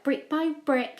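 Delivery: usual speaking voice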